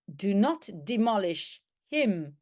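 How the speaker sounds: ordinary talk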